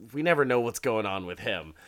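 ordinary delivery, nothing marked

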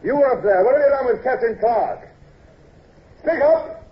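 loud, high-pitched shouted demand; hailing. Acted. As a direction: call up to someone far above. Officer hails a man up above.

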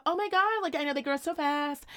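high gushing small-talk voice; gushing mom. Retold or imitated. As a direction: coo at the other moms. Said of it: "And I'd be like".

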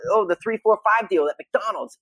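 youthful excited ad voice. Shown as a demonstration. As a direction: sell it like a fast food ad. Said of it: "youthful and exciting, you know, like McDonald's".

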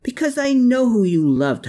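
high, loud accusing holler; hollering back. A narrator's reading. Herman hollers back at Klaus, high and loud. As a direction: fire it back at full volume. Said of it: "Herman hollered back".

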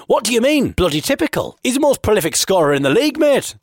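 high, quick, incredulous protest; indignant challenge. Acted. Fan defends the league's top scorer to blagger, indignant.

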